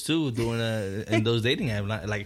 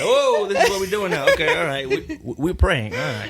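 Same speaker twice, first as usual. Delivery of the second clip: startled and laughing, loud; surprised; 9 semitones higher, 8 dB louder than usual. Retold or imitated. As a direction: caught off guard, half laughing.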